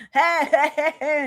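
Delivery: a hint of a stoned giggler; imitating stoned woman. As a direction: lightly mimic someone who is high. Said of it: "When Queen's all high and she's like".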